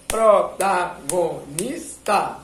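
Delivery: "Protagonista" split very slowly into PRO-TA-GO-NIS-TA; "Protagonista" drawn out in syllables. Shown as a demonstration. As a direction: tap "Protagonista" out in five beats. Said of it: "enquanto você tá falando as sílabas".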